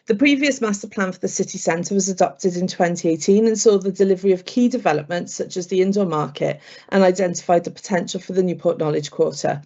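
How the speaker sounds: usual speaking voice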